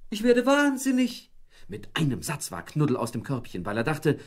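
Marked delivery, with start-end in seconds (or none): 0.1-1.3 high, loud frantic shriek; Frauchen's shriek. A narrator's reading. Housewife shrieking at the broken fridge. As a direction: shriek it in despair. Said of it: "da schrie Frauchen schon wieder".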